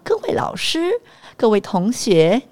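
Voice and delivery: speech contest diction. Retold or imitated. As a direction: declaim like a stiff school speech contestant. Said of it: "纠正我们的咬字，然后一定要".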